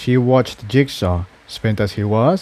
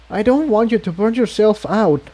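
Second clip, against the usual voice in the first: put-on higher, anxious young mare voice; worried Tiptoe; 8 semitones higher than usual. A narrator's reading. Tiptoe worrying Jigsaw will burn out, high anxious voice. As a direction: ask with worried concern, voice raised high.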